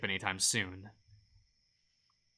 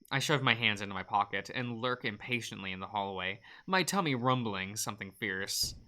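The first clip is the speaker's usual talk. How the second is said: usual speaking voice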